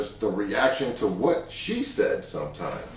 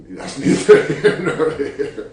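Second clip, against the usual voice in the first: slow, higher, laughing through the words; 4 semitones higher, 7 dB louder, slower than usual. Real, not acted. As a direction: brush it aside with a laugh. Laughs off his big number.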